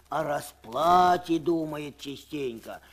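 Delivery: ordinary delivery, nothing marked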